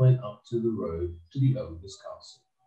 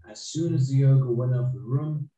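ordinary delivery

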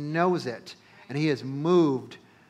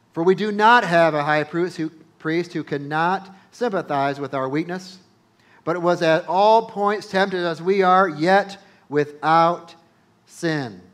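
loud, slow preaching read of the verse; scripture reading; 3 semitones higher, 7 dB louder, slower than usual. Acted. Preacher reading Hebrews to the congregation, loud and slow. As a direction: proclaim the verse, drawing out yet without.

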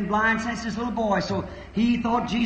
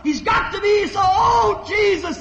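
shouting higher and louder, pleading; desperate cry; 10 semitones higher, 6 dB louder than usual. Retold or imitated. Blind man crying out to Jesus from the roadside, desperate shout. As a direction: cry out to be heard over the crowd. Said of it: "he called out".

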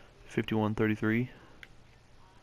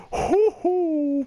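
high relieved whoop; 10 dB louder than usual. Real, not acted. Relief after nearly dying.

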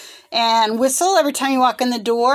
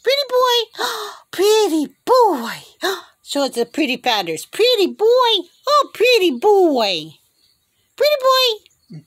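gushing pet coaxing; 11 semitones higher, 5 dB louder than usual. Real, not acted. Owner urging a bird to puff its feathers, high gushing coo. Repeatedly prompting bird's feather trick.

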